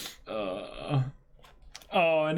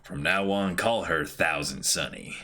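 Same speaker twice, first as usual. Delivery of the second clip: low, grand proclamation; low-voiced builder naming his ship; proud shipwright; 11 semitones lower than usual. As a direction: christen the ship in a deep boast.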